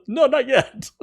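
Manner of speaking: very high, laughing protest; amused correction. Retold or imitated. Band stopping his early cheer, laughing correction. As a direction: imitate a group's objection through laughter.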